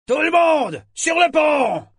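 high, full-voiced shout to the crew; joyful bawling. A narrator's reading. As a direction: bawl the order with gusto. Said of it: "brailla joyeusement Sorkor". (braillement joyeux, aigu et puissant)

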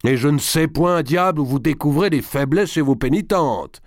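high, raging rebuke; shouted. A narrator's reading. Thwarted d'Artois berating the chaplain, shouting in rage. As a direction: shout it angrily, voice pitched right up. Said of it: "lui cria-t-il".

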